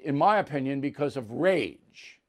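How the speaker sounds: normal talking voice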